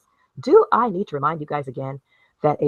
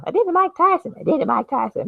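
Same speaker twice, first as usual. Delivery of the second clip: high, squeaky imitation; Mike Tyson video voice; 14 semitones higher than usual. Vlogger parodying a video she keeps seeing, mock high voice. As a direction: mimic the clip in a much higher voice. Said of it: "I need a remix of his voice".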